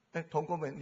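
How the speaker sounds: ordinary talk, nothing marked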